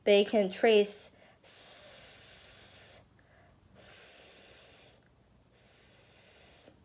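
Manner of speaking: long hissed s while tracing; drawn-out s like a snake. Shown as a demonstration. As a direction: hiss the s- slowly along the snake. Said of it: "Where here".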